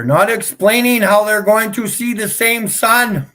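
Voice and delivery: high, loud challenge; loud insistence. Real, not acted. Streamer scolding viewers for not explaining, loudly insistent.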